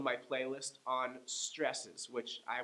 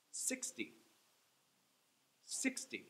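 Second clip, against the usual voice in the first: Sixty high on the first syllable; SIX-ty, first syllable high, then drop; stress on SIX; 10 semitones higher, 8 dB quieter, slower than usual. Shown as a demonstration. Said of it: "I'm saying each one twice".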